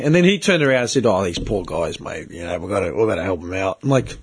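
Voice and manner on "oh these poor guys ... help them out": lower, softer imitation of a sympathetic coworker; a lower, quieter mock of the bloke at work. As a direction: put on the coworker's hushed pleading tone. Voicing coworker in retold argument.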